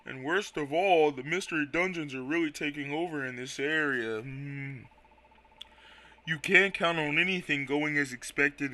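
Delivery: Quagsire voice. Acted. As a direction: let the words crawl out with a hum. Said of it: "my Quagsire voice".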